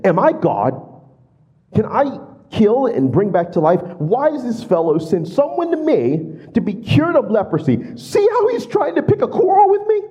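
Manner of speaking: high, rising panicked king's voice; a king tearing his robes, voiced in alarm. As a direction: read it as a frantic, cornered king. King reads letter in panic.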